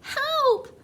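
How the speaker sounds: very high, drawn cry of panic; screaming for help. A narrator's reading. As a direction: scream it, pitch way up. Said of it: "screamed Missus Jenkins".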